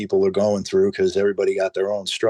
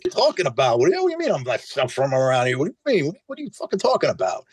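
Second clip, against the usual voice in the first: mock indignant; 6 semitones higher, much faster than usual. Retelling his indignant reaction.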